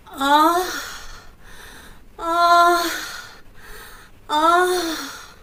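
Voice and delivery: loud, high, drawn-out moans; a mock clit moan, hammed up for laughs. Shown as a demonstration. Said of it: "There's a clit moan".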